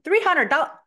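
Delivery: high, loud mock outcry at the price; shocked. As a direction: act out a customer balking. Acts customer balking at the price.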